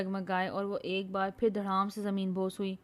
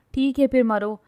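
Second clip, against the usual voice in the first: loud exasperated outburst; 4 semitones higher, 9 dB louder than usual. A narrator's reading. Man gives up on the girl, exasperated. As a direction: throw up your hands at her. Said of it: "جھنجھلاہٹ سے کہتا".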